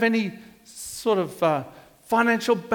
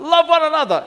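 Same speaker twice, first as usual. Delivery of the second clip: called back, higher and louder; children yelling back; 8 semitones higher, 6 dB louder, faster than usual. Retold or imitated. Pupils answering the headmaster, mock yell. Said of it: "they yell out".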